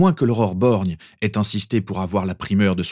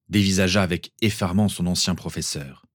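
usual speaking voice

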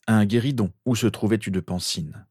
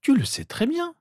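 put-on very high girl's voice; impatient sister. A narrator's reading. Sister answering Harry's eager question, impatient. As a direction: snub him in a high voice.

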